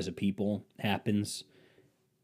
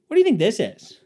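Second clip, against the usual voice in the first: higher, louder curious wife; wife picking things up while he paints, higher mock voice; 9 semitones higher, 8 dB louder than usual. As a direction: chirp it like a nosy question. Wife distracting him.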